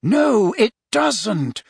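acted mentor losing patience; impatient. A narrator's reading. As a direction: overrule the pupil, emphatic. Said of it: "sounding impatient now".

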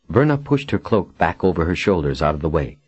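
ordinary delivery, nothing marked

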